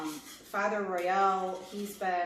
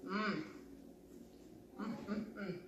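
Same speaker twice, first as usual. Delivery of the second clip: savoring hums; 12 dB quieter than usual. Real, not acted. Savoring food while chewing.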